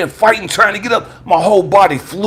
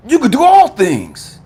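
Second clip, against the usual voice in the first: high, impassioned preaching cry; rousing affirmation; 5 semitones higher than usual. Retold or imitated. God reminds Elijah of his power, rousing proclamation. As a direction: lift the voice and proclaim it with force.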